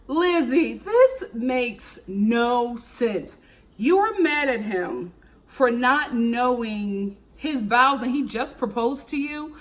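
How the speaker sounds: slow, drawn-out exasperated reasoning; slow disbelief. Retold or imitated. Vlogger lecturing Lizzie on the fake argument, slow and exasperated. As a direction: spell it out slowly like she can't believe it. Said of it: "And I'm like".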